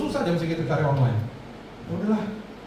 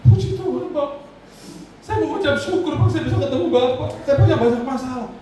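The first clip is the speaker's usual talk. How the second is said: high, loud tearful praise; sobbing driver thanking the pastor; driver crying out; 10 semitones higher, 3 dB louder than usual. Retold or imitated. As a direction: burst out weeping with gratitude. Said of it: "Tiba-tiba dia nangis".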